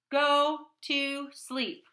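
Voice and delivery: loud, slow, high angry order; angry command. Acted. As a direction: bark it slowly, fed up. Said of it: "he seems really angry".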